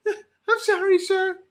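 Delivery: very high, whiny pleading voice; mock scared woman. Woman apologizing to the man, high mock-scared pleading. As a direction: squeak out the apology like a frightened girl. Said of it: "That was the face she made".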